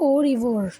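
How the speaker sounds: "ওরিভোর" loud and clear, o-ri-vor; pronunciation demo. Shown as a demonstration. Teacher giving learners the French farewell, "ওরিভোর" loudly as o-ri-vor. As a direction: model "ওরিভোর" firmly with long o's. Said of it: "এইটার উচ্চারণ হচ্ছে".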